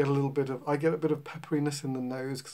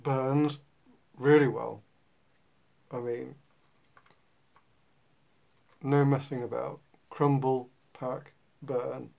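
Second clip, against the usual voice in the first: talking around pipe stem; pipe in mouth; slower than usual. Real, not acted. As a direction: teeth clenched on pipe. Seen 2: Pipe stem held in teeth, hands free, throughout the labelled words.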